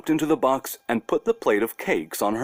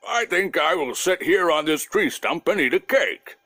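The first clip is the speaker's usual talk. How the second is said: lightly voiced weary bear; a bear ready for a rest and a snack; tired bear; 5 semitones higher than usual. Bear on the tree stump tempted by cakes, lightly voiced weary bear. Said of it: "and said".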